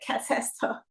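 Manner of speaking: breathy whispered disbelief; a hushed what-is-this; shocked whisper. Retold or imitated. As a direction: whisper it, stunned. Shocked at classmates in pajamas.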